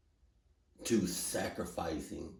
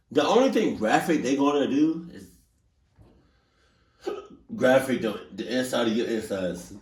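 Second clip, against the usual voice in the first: loud rant; 5 semitones higher, 8 dB louder than usual. Pretend. Hiccuping rant about crocodiles.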